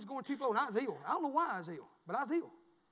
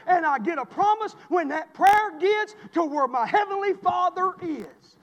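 rising, louder preacher's build, hammering each line; preaching at full force; 7 semitones higher, 13 dB louder than usual. Real, not acted. Pastor building up to the congregation, full-force preaching.

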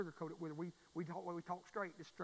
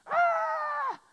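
imitating a baby's sudden high wail; 11 dB louder than usual. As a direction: let out a loud, high baby howl. Imitating his baby son's cry.

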